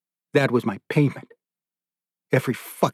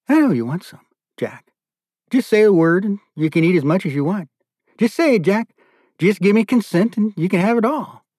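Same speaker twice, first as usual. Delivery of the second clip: higher, teasing coax that climbs; taunting captor; 6 semitones higher than usual. Retold or imitated. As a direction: dangle the food, sweetly cruel. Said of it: "and he'd say".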